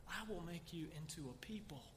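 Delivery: hushed whisper of God's promise. Acted. Preacher voicing God to David, whispering the promise. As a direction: breathe it out like a secret.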